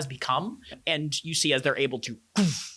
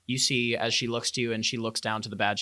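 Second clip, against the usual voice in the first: ordinary talk, nothing marked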